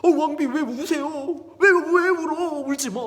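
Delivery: high, loud dismayed questions; flustered concern. Acted. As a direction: act a king thrown by his queen's tears. King alarmed by weeping queen.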